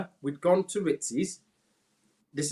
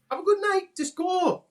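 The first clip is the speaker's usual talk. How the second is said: a hint of exasperated mum, higher; lightly voiced mum telling him to go; mum dismissing.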